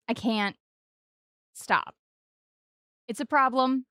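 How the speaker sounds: ordinary delivery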